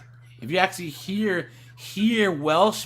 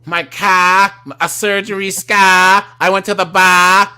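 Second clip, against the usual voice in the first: loud Boston accent, R's dropped; hammed-up Boston accent with broad open vowels; 8 dB louder than usual. Shown as a demonstration. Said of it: "in the Boston accent".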